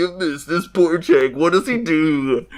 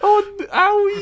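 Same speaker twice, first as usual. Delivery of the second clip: much higher, whiny owie cries; high whimpering hostage; 3 dB louder, slower than usual. Acted. Cheese boy hostage whimpering under the pistol, high and whiny. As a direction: squeal like a beaten hostage.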